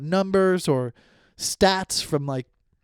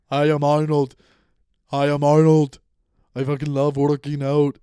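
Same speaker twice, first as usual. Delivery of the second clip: put-on hammy Austrian accent, loud and heavy; half-embarrassed attempt at an Austrian accent; Arnold Schwarzenegger; 6 dB louder than usual. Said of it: "try to do the accent".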